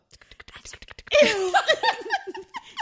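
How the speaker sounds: loud, high burst of laughter; real cackle breaking into a squeal; shrieking laugh. Real, not acted. Co-host cracking up at spooking her friend, shrieking laugh.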